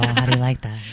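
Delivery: low, smooth radio DJ voice; sexy radio voice. Pretend. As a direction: keep the late-night radio purr. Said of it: "this is my sexy radio voice".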